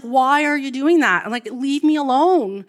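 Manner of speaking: retold frustrated shout; frustrated outburst. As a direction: snap back in exasperation. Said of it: "really frustrated".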